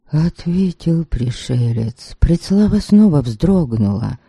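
ordinary delivery

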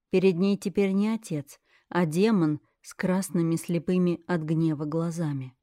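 plain reading voice

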